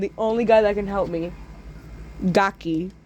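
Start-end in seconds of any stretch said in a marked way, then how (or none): none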